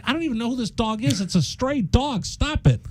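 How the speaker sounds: high-pitched mock exasperation with his wife; exasperated protest. Acted quarrel with his wife.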